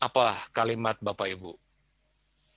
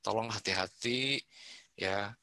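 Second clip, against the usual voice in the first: gentle caring policeman; 2 semitones lower, 7 dB quieter than usual. Retold or imitated. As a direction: speak like a polite cop who cares. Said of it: "bahkan ditutup dengan kalimat". (polisi santun, lembut dan pelan)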